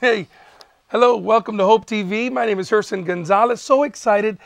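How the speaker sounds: usual speaking voice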